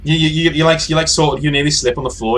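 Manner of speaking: game master gleefully narrating a player's slip; excited. Real, not acted. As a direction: rush it out, amused.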